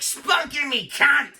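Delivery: high British caricature shout; mock British accent. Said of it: "when it's used like that".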